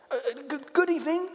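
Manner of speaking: put-on high, hesitant girl's greeting; timid little girl. A narrator's reading. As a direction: stammer a quick, high hello. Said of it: "said Lucy".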